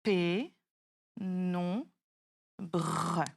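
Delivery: P, non, bre, separated by pauses and low in pitch; P, non, bre chopped into syllables for dictation. Shown as a demonstration. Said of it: "Je t'indique le nombre de syllabes".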